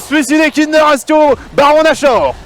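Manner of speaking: shouting the big play, high; screamed hype call; esports caster. Acted. Caster calls the Nashor steal.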